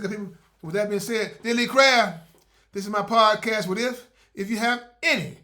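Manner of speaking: loud announcer sign-off; podcast outro. Acted. Host closing his podcast, loud announcer read. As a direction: sign off the show with energy.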